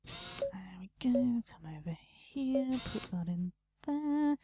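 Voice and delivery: whispering under his breath; whispered. Real, not acted. Gamer muttering moves to himself, whispered. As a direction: whisper the steps to yourself.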